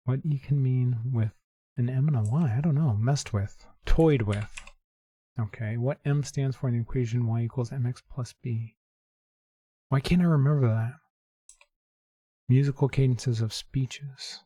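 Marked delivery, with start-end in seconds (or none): none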